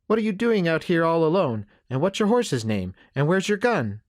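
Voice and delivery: put-on high, eager little boy voice; curious boy. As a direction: fire off questions like an inquisitive kid.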